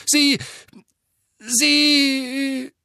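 high voice cracking off; voice breaking. A narrator's reading. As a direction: let the voice break. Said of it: "seine Stimme brach".

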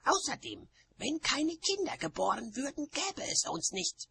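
high-pitched, indignant witch; high character voice, heated; quarrelling witch. A narrator's reading. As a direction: argue back in a high, strained voice.

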